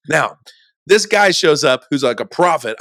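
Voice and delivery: ordinary talk, nothing marked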